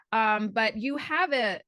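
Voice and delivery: ordinary talk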